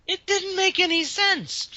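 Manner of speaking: very high, drawn-out comic whine; incredulous high complaint; exasperated protest. Real, not acted. Comic rant at absurd plot.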